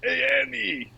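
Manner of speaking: high-pitched New York accent impression; Watto. Star Wars alien calling Andy over, New York accent. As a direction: call out as a wheedling alien junk dealer. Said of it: "I picture Watto from Star Wars".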